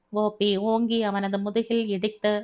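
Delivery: usual speaking voice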